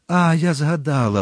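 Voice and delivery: ordinary delivery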